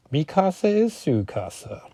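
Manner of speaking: high-pitched mock Spanish accent; bad Spanish accent. Driver welcoming his passengers in a bad Spanish accent. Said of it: "my Spanish accent. Atrocious".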